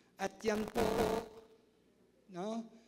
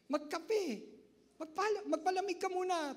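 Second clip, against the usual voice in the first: amused; 7 semitones higher than usual. Real, not acted.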